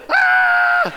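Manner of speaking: a high, loud startled scream. Retold or imitated. Son in the tree startled by the running buck, high loud scream. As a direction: shriek as the buck runs right at you. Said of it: "I hear Paul go".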